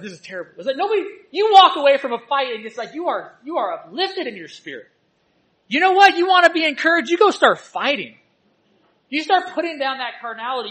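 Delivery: fiery preacher exhortation, loud and high; preaching at full force. Acted. Preacher rallying the church to stand up for truth, fiery preaching. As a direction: rouse the congregation to fight for truth.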